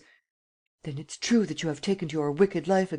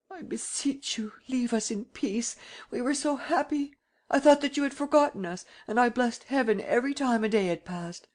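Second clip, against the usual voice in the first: tearful pleading; 9 semitones higher than usual. A narrator's reading. Old lady begging Sernine to leave Geneviève alone, tearful and high. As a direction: beg him with a breaking old voice. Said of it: "She began to cry ... implored him".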